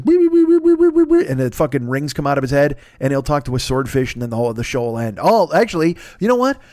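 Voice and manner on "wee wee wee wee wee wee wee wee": shrill high-pitched wee wee sounds, louder; Aquaman's squeaky wee-wee call, way up high; high squealing wees. Pretend. Aquaman doing his thing, squealing high wee sounds. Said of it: "Aquaman will do that thing where he goes".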